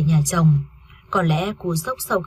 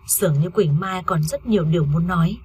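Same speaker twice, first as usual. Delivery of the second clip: usual speaking voice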